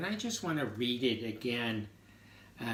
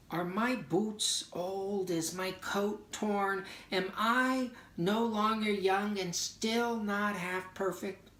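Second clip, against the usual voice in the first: higher, slow, pleading questions; reading the poem's doubts, pitched up and searching; doubting questions; 7 semitones higher, 3 dB louder, slower than usual. Shown as a demonstration. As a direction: ask each doubt as if it stings. Doubting lines of poem.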